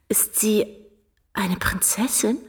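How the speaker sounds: soft, hushed whisper; awed whisper. Acted. Gertrude asks the visitor about the princess, awed whisper. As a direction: breathe it out, dazzled by the title. Girl dazzled by royal title.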